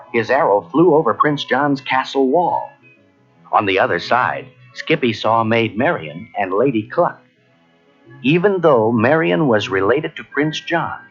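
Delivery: ordinary delivery, nothing marked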